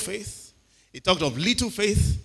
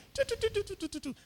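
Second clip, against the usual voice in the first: high soft pitter-patter; tiny dog trotting sounds; pattering footsteps; 9 dB quieter, faster than usual. Retold or imitated. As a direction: mimic a little dog trotting. Imitating dog's little steps.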